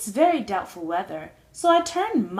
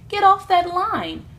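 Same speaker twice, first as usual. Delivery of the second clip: high, loud shout to get off the phone; angry shout; 8 semitones higher, 9 dB louder than usual. A narrator's reading. Sister shouts at the glunk on the phone, loud and high. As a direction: yell at him to hang up. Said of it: "Sister cried".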